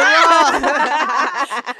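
loud, high retold whoop; imitating own reaction. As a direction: exclaim it high and loud over laughter. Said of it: "I was like".

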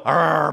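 mock dog growl in tug of war; playful growl. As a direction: growl like playing with a puppy. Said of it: "I go".

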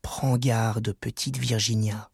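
low, soft, sinking into a whisper; hushed warning. A narrator's reading. Horrible creatures warning little Virginia, hushed low voice. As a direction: warn her under your breath. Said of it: "lui dirent à voix basse".

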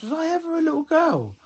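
very high, small imitation of mum; mum's questioning voice. Retelling mum's repeated question from the wheelchair, imitated wondering. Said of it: "She goes".

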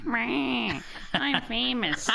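high nasal impression voice; nasally voice. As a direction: pinch it through the nose, high. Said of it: "nasally voice".

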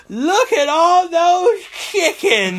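put-on high, slow, loud little-girl voice with a lisp; drawn-out child's lisp, full of wonder. Quoting the Vine girl over ducks, high slow lisp. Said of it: "she has a very heavy lisp".

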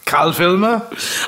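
put-on slow, stiff German general accent. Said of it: "He sounds like a German general".

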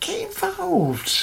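high, soft teasing invite; a sly high-pitched lure; falsetto come-on. Pretend. Hammy podcast promo.

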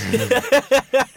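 loud, high burst of laughter; real, helpless cackle of amusement; big laugh. Real, not acted. Amused by a co-host's joke.

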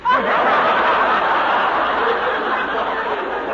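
loud breathy burst of laughter. Acted. Clara laughs at the baby in disguise, loud laughing burst.

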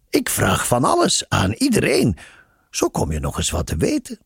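playful, lilting self-praise; tease, starting high then easing down; teasing. A narrator's reading. Nun boasting that she asks everyone everything, teasing. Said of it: "zei ze plagerig".

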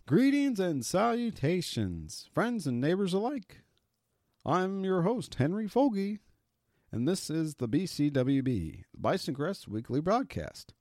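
Henry impression. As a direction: play the pompous vintage broadcaster. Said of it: "Henry impression".

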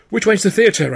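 high, loud call while running; runaway shouting to his partner. A narrator's reading. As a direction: shout it over the noise of the chase. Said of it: "I shouted".

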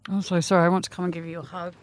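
normal talking voice

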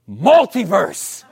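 startled cry. Retold or imitated. Husband waking in the dark, calling out startled. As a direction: blurt it out like you just woke from a nightmare.

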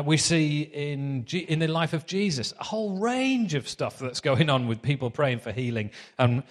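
ordinary talk

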